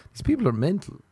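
ordinary delivery

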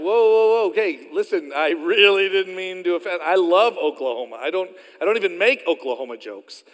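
loud, backpedalling apology; flustered protest. Retold or imitated. Preacher retelling his apology to an offended lady, loud flustered protest. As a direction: jump in high and loud to calm the offence.

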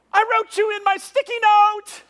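very high, loud comic yell; hammed-up, over-excited holler; hollering back. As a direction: shout it across the water. Yelling back to friend across water.

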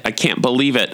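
usual speaking voice

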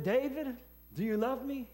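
God's voice calling out, insistent; pressing question. Retold or imitated. God asks three times, pressing.

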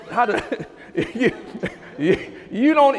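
stammering through laughter; laughing. Real, not acted. Preacher amused at his own Jabumba example, laughing. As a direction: break up laughing mid-sentence.

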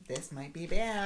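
slow, high, drawn-out misgiving; uneasy aside. Retold or imitated. Imitating a teacher privately doubting her encouragement, uneasy. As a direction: draw it out high with pretend worry.

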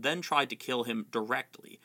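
ordinary talk

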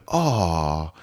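falling sympathetic aww; audience sympathy. Retold or imitated. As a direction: imitate a crowd feeling sorry. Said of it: "the whole audience went".

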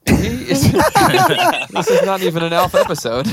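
laughing through words; giggling while talking. Real, not acted. Laughing over Alf talk.

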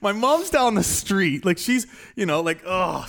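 slowly spiral into panic about mom; frantic panic. Retold or imitated. Panicking young applicant about his mom, mock frantic.

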